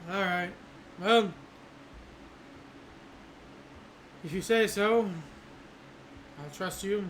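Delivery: resigned captain giving orders; a sigh, then firm orders. Acted. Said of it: "kinda sigh and say".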